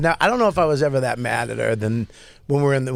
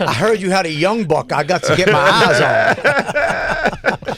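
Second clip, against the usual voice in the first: slow drawling buyer; 3 semitones higher, 4 dB louder, slower than usual. Pretend. Playing buyer on the farm.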